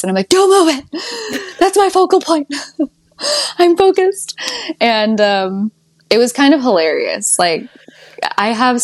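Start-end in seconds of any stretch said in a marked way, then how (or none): 0.3-4.4 high, laughing, half-crying plea. Retold or imitated. To husband about her focal point, high laughing plea. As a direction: laugh through a mock desperate protest. Telling husband not to move lint.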